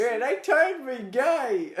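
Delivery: hillbilly abductee. Pretend. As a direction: protest like an indignant hillbilly. Continuing hillbilly bit.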